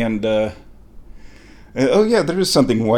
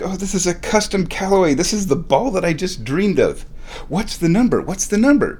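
higher-pitched eager excitement; freaking out; 7 semitones higher than usual. Retold or imitated. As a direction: re-enact frantic curiosity over a find. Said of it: "And I'm freaking out".

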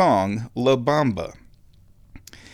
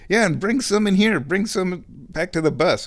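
eager high call; 8 semitones higher than usual. Retold or imitated. Band member calls out.

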